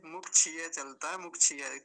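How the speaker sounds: मुक्षीय said as muk-chhi-ye; chh for ksh. Shown as a demonstration. Teacher mimicking the common error, chh for ksh. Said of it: "छकार".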